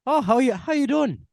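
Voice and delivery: high, loud, hammed-up Irish; fake Irish accent. Shown as a demonstration. Co-host takes a turn at the requested accent, hammy Irish. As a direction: lilt it up in bad Irish. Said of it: "I'm gonna try after you".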